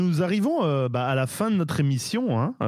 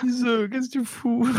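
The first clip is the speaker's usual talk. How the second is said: high mock exasperation; 9 semitones higher than usual. Real, not acted. Protesting the otter sound.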